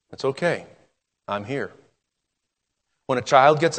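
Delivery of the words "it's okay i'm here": soft, hushed comfort; gentle reassurance. Acted. God answers the frightened believer, soft and reassuring. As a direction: reassure like a parent at night.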